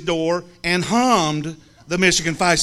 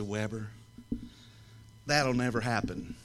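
low, soft refusal; 8 semitones lower, 11 dB quieter than usual. Retold or imitated. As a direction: lower and softer, a flat no. Flat refusal to friend.

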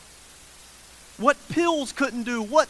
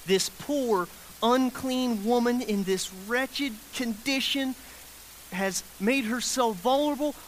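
ordinary talk, nothing marked

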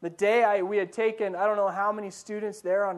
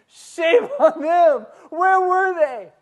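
laughing mock outrage, much higher and louder; slow, hammed-up "shame on them" with a laugh; mock scolding; 9 semitones higher, 10 dB louder, much slower than usual. Mock scolding the sleeping disciples.

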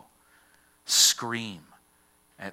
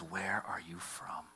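slow, breathy whisper; whispered question; 3 semitones lower, 6 dB quieter, slower than usual. Retold or imitated. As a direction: ask it low and slow, almost to yourself. Pilate questions Jesus, whispered.

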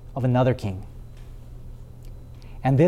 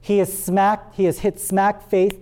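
normal talking voice

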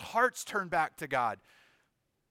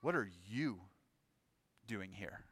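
hushed question weighted on "you"; low, quiet, "you" set apart by a pause; stress on you; 6 semitones lower, 9 dB quieter than usual. Shown as a demonstration. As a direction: lean on "you", pause, trail off softly. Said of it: "maybe other people would be in hiding, but".

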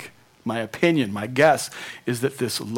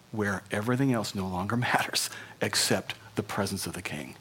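awed whisper; 4 semitones lower, 8 dB quieter than usual. Real, not acted. Awed hush about God's presence.

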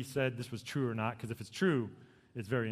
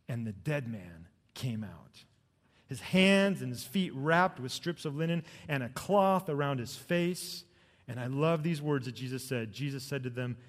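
ordinary talk, nothing marked

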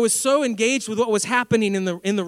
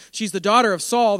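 ordinary talk

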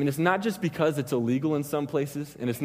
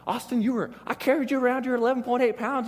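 high-pitched imitation of an exasperated mother; mock motherly scolding in a high voice; frustrated mom; 10 semitones higher than usual. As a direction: mimic mom complaining, pitched way up. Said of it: "whenever I frustrate her, she's like".

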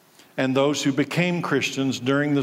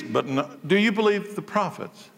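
ordinary talk, nothing marked

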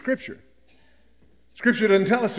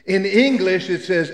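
normal talking voice

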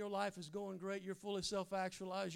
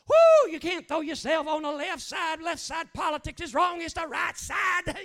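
loud, high hammed-up preacher shouting; mock preacher rant; 11 semitones higher, 19 dB louder than usual. Preacher mocking a sermon on politics, loud hammed-up rant. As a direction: ham up a fire-and-brimstone preacher.

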